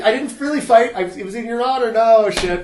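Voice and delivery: high, loud, protesting excuse; mock pleading. Guy excusing his fight to girlfriend, loud mock pleading. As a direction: plead your case, hammed-up and high.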